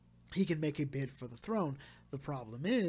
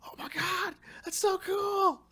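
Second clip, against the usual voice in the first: much higher, louder, gushing fangirl squeal; squealing excitement; 5 dB louder, slower than usual. Retold or imitated. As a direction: squeal it like a thrilled fan who can't contain it. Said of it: "this movie had me going".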